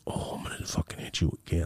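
hushed whispered threat; threatening whisper. Retold or imitated. Kid eyeing crying friend, threatening whisper.

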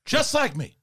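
high-pitched movie-star rant in separate bursts; Al Pacino. Acted. Actor argues with his director over casting, high Pacino impression. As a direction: climb high in mock indignation, dip, rise again. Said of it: "Pacino just went from being like that really high voice".

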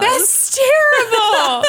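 high, drawn-out wail through laughter; amused dismay. Real, not acted. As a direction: cry out in laughing horror. Appalled, amused by armed Santa.

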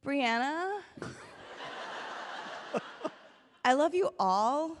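put-on Valley Girl accent. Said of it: "I'll Valley Girl it for Richard".

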